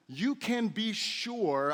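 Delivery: slow, every word of "you can be sure" weighted, stress on "you"; slowed, assured "you can be sure" with a firm fall at the end; certain emphasis. Shown as a demonstration. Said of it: "I love the certainty in Jesus' tone".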